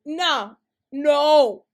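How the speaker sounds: loud, final no no; firm no. Real, not acted. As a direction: shut it down hard.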